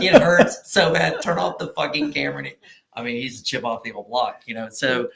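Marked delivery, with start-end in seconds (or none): 0.0-2.4 pained yell. Retold or imitated. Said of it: "I was like".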